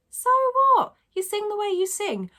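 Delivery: high, loud dismissive retort, easing off; defiant. Real, not acted. Teacher shrugging off critics of cat-like singing, defiant. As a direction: brush it off with a high "so what".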